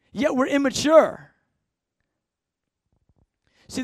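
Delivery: ordinary delivery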